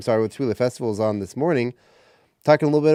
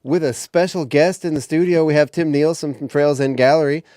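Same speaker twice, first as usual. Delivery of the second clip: radio announcer; 4 semitones higher, 5 dB louder than usual. Acted. Radio host opens the show.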